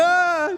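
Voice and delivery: high, loud startled "Ah!"; mock scream of fright; scared scream. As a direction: yelp as if nearly pushed off a ledge. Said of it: "got a little scared".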